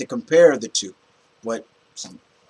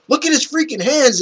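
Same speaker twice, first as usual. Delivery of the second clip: much higher, insistent; excited urging; 13 semitones higher, 5 dB louder than usual. Retold or imitated. Pointing out hands to his bro, high and excited. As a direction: really make him look.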